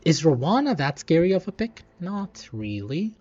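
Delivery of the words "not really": usual speaking voice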